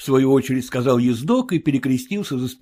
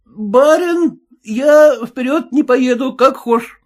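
much higher, louder wary coachman; high suspicious cabbie; cab driver voice; 12 semitones higher, 6 dB louder, slower than usual. Acted. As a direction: play the sly cabbie, pitched well up.

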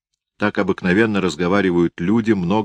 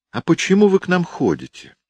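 put-on a defiant little boy speaking up; child voice; 12 semitones higher than usual. Boy tells aunt Liza, child voice.

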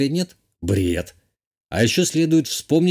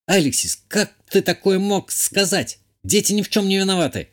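higher-pitched, reproachful woman's voice; indignant countess; 8 semitones higher than usual. A narrator's reading. Countess scolds the man for threatening to kill, indignant. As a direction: raise the pitch in shocked reproach.

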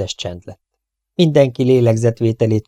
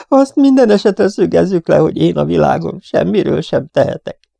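much higher, whining tearful voice; whimpering gangster; 8 semitones higher, 4 dB louder than usual. A narrator's reading. Diamond Octave weeping to the inspector, whining high. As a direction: sob out self-pity. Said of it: "sírdogáló Diamond Octave".

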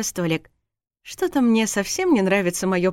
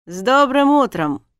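loud, higher, mock-cheery greeting; smirking; 6 semitones higher, 7 dB louder than usual. A narrator's reading. Husband greets hungover wife, smirking. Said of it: "ухмыльнулся змей".

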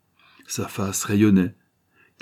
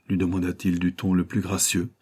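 ordinary delivery

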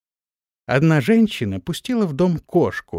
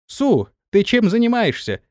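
higher-pitched shout to a subordinate; calling out sharply; 8 semitones higher than usual. A narrator's reading. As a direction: call her out, pitch jumping up. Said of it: "крикнула она".

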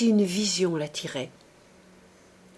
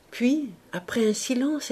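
ordinary delivery, nothing marked

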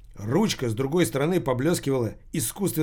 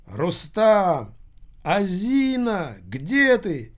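higher-pitched, drawn-out calls; calling out; 6 semitones higher, much slower than usual. A narrator's reading. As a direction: call out for someone missing, stretching the names. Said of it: "кричали то и дело модели".

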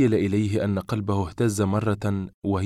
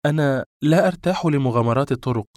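higher, cool refusal; cold; 6 semitones higher, 4 dB louder than usual. A narrator's reading. Woman displeased with his answer, cold. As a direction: turn him down coolly. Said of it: "وقالت ببرود".

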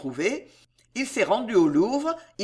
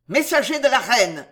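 growling; 3 semitones higher, 5 dB louder than usual. A narrator's reading. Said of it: "gronde-t-il".

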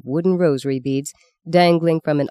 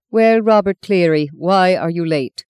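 a stern nun's dry bark, louder; dry barked question; 3 semitones higher, 6 dB louder than usual. A narrator's reading. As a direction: bark it like a strict Irish nun. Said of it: "Sister Agatha barked in her dry, once Irish voice".

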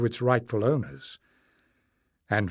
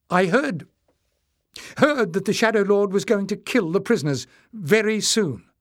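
urgent disclosure; 8 semitones higher, 4 dB louder than usual. A narrator's reading. Jasmine urgently reveals a threat.

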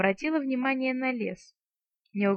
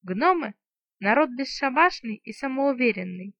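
ordinary delivery

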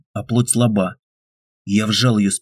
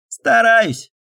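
Pyotr's voice; 13 semitones higher than usual. A narrator's reading. Pyotr answers hero, voiced.

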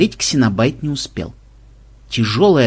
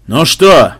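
booming, louder, slow from a helmet; inside a knight helmet; 6 dB louder, much slower than usual. A narrator's reading. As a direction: boom it out through a visor. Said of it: "прогудело из-за шлема".

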